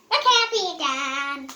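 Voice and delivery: ordinary delivery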